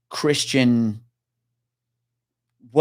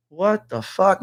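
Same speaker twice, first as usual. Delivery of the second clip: retold bewilderment; 8 semitones higher, slower than usual. His reaction to jerking body, retold.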